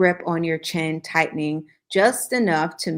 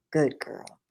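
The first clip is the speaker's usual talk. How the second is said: low growl; 4 semitones lower, 5 dB quieter than usual. A narrator's reading. The hero praising her in a low growl. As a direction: lower and softer, a hint of a growl. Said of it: "he growled, low and feral".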